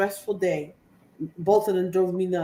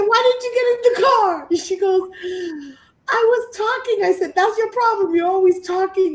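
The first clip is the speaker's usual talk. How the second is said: high, loud exasperated scolding, much higher and louder; 10 semitones higher, 6 dB louder than usual. Retold or imitated. Scolding her mother for not getting in the car, loud and high. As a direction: tear into her for dawdling.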